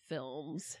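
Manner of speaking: normal talking voice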